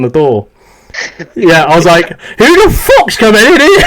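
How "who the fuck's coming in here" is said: pitched way up, a startled half-shout; startled outburst. Retold or imitated. Soldier alone in his room hears the door code, startled half-shout. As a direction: jump at the sound of someone coming in.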